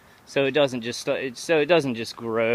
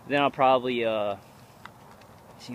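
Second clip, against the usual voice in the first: ordinary talk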